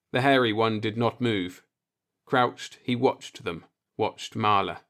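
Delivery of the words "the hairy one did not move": ordinary delivery, nothing marked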